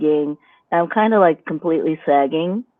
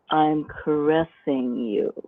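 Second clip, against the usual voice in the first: softer, slow caressing line; slowly paced, softer seduction; 2 semitones lower, 6 dB quieter, slower than usual. Shown as a demonstration. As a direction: pace each word like a stroke. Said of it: "I might even pace it like".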